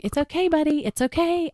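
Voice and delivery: put-on high, gentle voice calming a scared child; soothing reassurance. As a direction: reassure the little boy, keep it light and high. Mother calming her son after crash.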